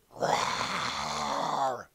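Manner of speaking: long throaty mock roar; one drawn-out pretend beast roar; dragon roar. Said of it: "gave a loud roar".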